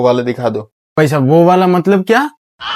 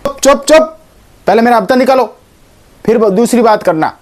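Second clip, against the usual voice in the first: don shouting them down; 7 semitones higher than usual. Acted. Don silencing everyone.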